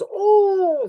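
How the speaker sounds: high, drawn-out ooh; imitated ooh. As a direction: imitate the table's reaction with a loud high ooh. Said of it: "like that".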